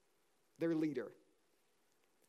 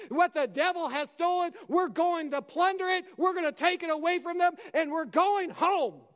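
rallying battle cry; 5 semitones higher than usual. Retold or imitated. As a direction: rouse the army to fight. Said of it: "he said".

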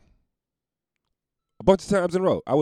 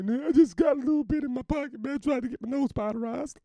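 cokehead voice. Retold or imitated. Voicing the cokehead.

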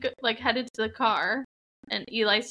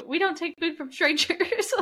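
mom's warning; 7 semitones higher than usual. Retold or imitated. Acting mom warning her child.

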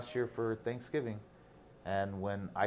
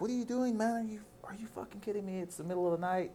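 retold high-pitched scolding from a friend; 10 semitones higher than usual. Friend waking him at noon, high mock scolding. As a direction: mimic his disbelief, pitched way up. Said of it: "and he's like".

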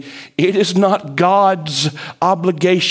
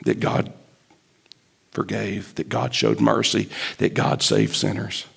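sulky mutter; 6 semitones lower, 6 dB quieter than usual. Retold or imitated. Jonah's sulky confession.

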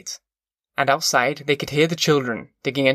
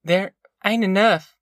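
humble admission, pitched higher; apologetic country mother; 6 semitones higher than usual. A narrator's reading. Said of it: "she said humbly".

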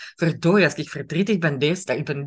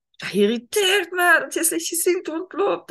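high, exasperated grumble at the partner; irritated inner voice; 13 semitones higher than usual. Retold or imitated. Thinking irritably about a grumpy partner, high exasperated voice. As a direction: huff it out, fed up. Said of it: "En je denkt".

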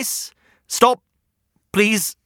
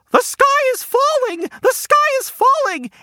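yelling hen. Acted. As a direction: cry the sky is falling like a panicked hen. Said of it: "and yelled".

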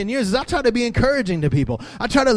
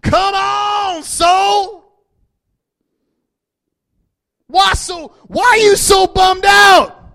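much higher, loud, slow shouted plea; speaking to your soul; 9 semitones higher, 6 dB louder, much slower than usual. Shown as a demonstration. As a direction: command your own soul, full force. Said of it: "just start saying".

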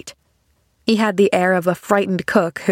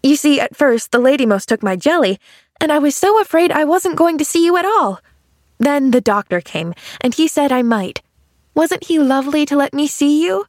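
put-on higher, lilting young girl's voice; eager little girl Pollyanna; 7 semitones higher than usual. Girl visiting a sick man, eager chatter. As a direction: chatter eagerly like an excitable child.

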